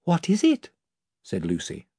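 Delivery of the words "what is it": put-on light, high girl's voice; a curious young girl asking. A narrator's reading. Lucy voiced in the story.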